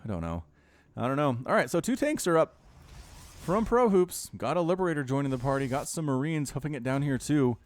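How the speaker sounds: normal talking voice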